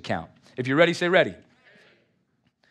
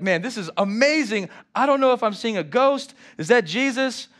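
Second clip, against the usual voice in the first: amazed disciples; 6 semitones higher, 4 dB louder than usual. Retold or imitated. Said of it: "They're like".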